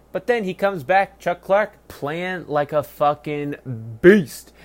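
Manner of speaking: rising, punching out beast loud; hyped up. Real, not acted. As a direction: build up and slam the last word. Hyping a player's big game.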